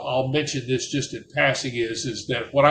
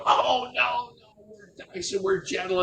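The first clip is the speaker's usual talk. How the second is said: high, soft, mock-innocent ladies protesting; a hint of playful ladies insisting they're gentle, pitched high; 9 semitones higher, 5 dB quieter than usual. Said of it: "the Vietnamese ladies were going".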